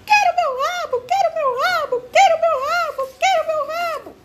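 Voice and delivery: very high, loud whiny monkey cry; shrill squeaky monkey sobbing for its tail; wailing monkey. A narrator's reading. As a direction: wail like a heartbroken little monkey. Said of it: "gemendo e chorando".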